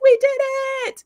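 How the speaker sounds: high, loud mock victory cheer. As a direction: cheer it out like a triumphant crew. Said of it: "they're all cheering and high-fiving each other".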